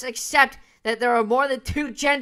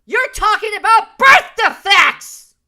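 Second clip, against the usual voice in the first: loud, high-pitched outburst; indignant shout; 9 semitones higher, 10 dB louder than usual. Real, not acted. Commentator cutting in on the person in the video, indignant shout. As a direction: shout it as an exasperated rebuttal.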